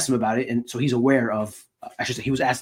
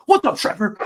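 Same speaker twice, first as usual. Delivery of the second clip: fired-up coach imitation; 9 semitones higher, 5 dB louder than usual. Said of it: "So he's like".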